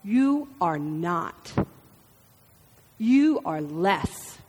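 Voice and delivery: slow, low, belittling; the inner voice. Acted. Other voice tells us we are worth nothing, slow and belittling. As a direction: drag it out like a sneering inner critic. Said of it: "to tell us".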